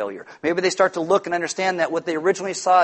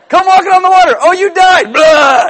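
much higher, loud, sneering mock spook; mean poltergeist ghost; 12 semitones higher, 14 dB louder than usual. An imagined poltergeist luring Peter onto the water, as a hammed-up mean ghost. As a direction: ham up a spiteful ghost. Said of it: "if he was a mean poltergeist ghost".

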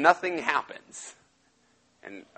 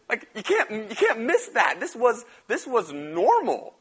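higher and laughing through the words; 7 semitones higher than usual. Real, not acted. Preacher laughs at the ancient custom, real laugh. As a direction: laugh in disbelief as you talk.

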